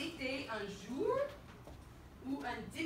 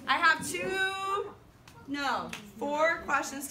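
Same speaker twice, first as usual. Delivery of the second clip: loud, high teacher call; rally a noisy class; raised voice, stretching the last word; calling for attention; 5 semitones higher, 6 dB louder, slower than usual. Real, not acted. Calls class back to attention.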